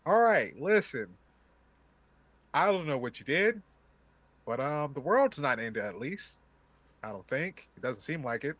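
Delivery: put-on loud Boston-accented NPC. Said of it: "Still having that Boston accent".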